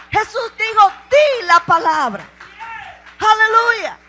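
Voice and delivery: high, loud exhortation building to a cry; preaching at full force. Acted. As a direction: stir the congregation up. (exhortación a toda voz)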